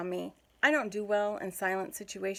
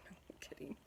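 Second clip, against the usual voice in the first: breathy chuckle into a whispered retraction; softer laughing aside; 20 dB quieter, faster than usual. Real, not acted. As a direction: whisper it through a laugh, teasing. Jokey aside after story.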